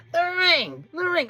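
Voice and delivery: high, strained creature voice, imitated; Gollum. Wrestler begging Okada for the ring, creature impression. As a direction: plead for it in a high-pitched creature croon. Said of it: "full on Gollum".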